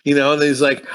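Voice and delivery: ordinary talk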